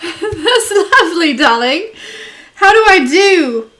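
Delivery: put-on high, loud playful British accent; mock British accent. Answering a viewer with a mock British accent. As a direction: ham up a British accent for the chat. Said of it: "you're full on British".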